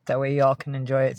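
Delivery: usual speaking voice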